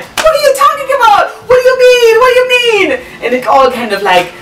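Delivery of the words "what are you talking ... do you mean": very high, loud, fast alarmed cry; panicked self-reproach. Retold or imitated. Teen girl shocked at her own thought, shrieking high. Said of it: "I was like".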